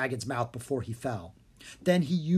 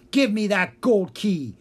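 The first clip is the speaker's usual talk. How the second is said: louder, higher snarling villain yell; 6 semitones higher, 7 dB louder than usual. A narrator's reading. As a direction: demand the key with menace. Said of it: "he yelled".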